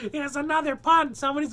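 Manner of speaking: high, loud laugh into the words; laughing at own pun. Real, not acted. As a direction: crack up at your own joke.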